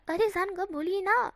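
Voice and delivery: loud, high playful coaxing. Acted. Wing coaxing his lover, high and playful. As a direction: sweet-talk him into answering.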